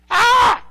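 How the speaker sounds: sudden high, loud scream; little boy's scream. Retold or imitated. Little boy in the other room, screaming. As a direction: yelp out a loud imitated child's scream. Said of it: "heard her little boy screaming".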